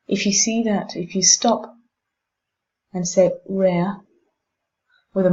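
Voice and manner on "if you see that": ordinary talk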